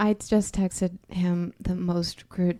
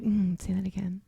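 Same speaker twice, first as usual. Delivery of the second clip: hushed, husky murmur, softer; smitten reply; 5 dB quieter than usual. Retold or imitated. Wife replays her reply to sick husband, smitten whisper. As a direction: whisper it as if swooning at his voice.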